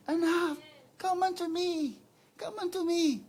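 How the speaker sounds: high pleading call, lightly voiced; God inviting. The Lord inviting his child, high pleading voice. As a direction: call the lost child home. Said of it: "nag-iimbita si Lord".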